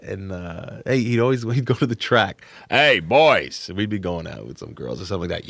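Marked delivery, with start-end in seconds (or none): 2.7-3.7 put-on loud gruff holler to the neighborhood boys; big gruff shouted greeting; Mister Giammalvo. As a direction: call out like a rough old porch-sitter. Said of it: "gruff voice, talk like this".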